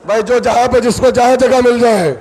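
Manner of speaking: loud, high preaching cry; impassioned preaching. Acted. Preacher urges the gathering to sit and weep, loud and high. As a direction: urge the crowd to weep.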